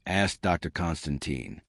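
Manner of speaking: plain reading voice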